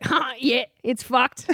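lightly voiced laid-back Aussie dad; a hint of true-blue Aussie, laughing off trouble; old school Aussie dad. Daughter imitating her true-blue dad, lightly voiced old school Aussie. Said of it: "one of these people who's always like".

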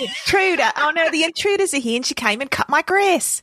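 high, hammed-up Australian accent; playful Aussie alarm. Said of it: "wonderful accents like that".

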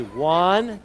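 ordinary talk, nothing marked

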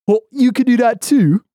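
high, loud Phoenix game voice; Phoenix impression. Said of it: "I'll do Phoenix first".

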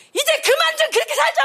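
high-pitched exasperated outburst; indignant plea to stop; acted anger. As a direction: cry out as if fed up. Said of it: "이 비느하스가 화가 나는 거야".

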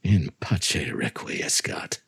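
slow, hushed whisper to close the tale; whispered final line. Acted. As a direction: breathe the last words like a secret. Final line of horror story.

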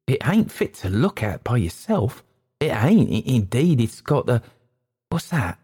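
high, faltering, agitated warning; anxious waiter breaking off in alarm; frightened waiter. Acted.

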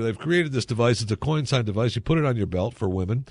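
ordinary delivery

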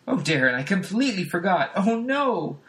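mock dismay of a TV housewife; flustered sitcom wife. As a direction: wail that you forgot. Wife panics over dinner.

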